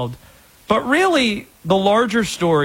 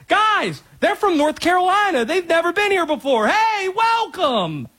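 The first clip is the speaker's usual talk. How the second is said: much higher, mock-eager Southern hosts piling on offers; eager welcoming fans; 12 semitones higher than usual. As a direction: ham up the overfriendly locals, climbing into a final welcoming shout. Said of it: "they were like".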